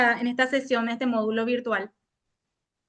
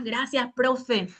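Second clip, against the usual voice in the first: normal talking voice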